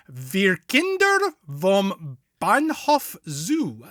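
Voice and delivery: slow, deliberate German pronunciation; faked German accent. Shown as a demonstration. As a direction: fake your way through German, drawn out. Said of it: "watched enough Fassbender to, to fake it".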